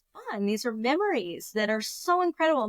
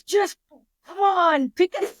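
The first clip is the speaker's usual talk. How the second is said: high, raised-voice imitation of urging; impatient urging; 9 semitones higher, 5 dB louder than usual. Husband urging wife to lift the cooler, imitated impatience. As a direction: push someone impatiently, as retold.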